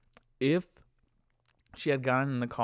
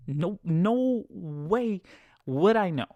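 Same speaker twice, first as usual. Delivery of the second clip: "No, no way" drawn out slowly and high; emphatic denial; 6 semitones higher, 4 dB louder, much slower than usual. Retold or imitated. Driver denying he knew the passenger's ethnicity, emphatic denial with "no way" drawn out. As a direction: stretch "no way", then punch "would I know" high and louder.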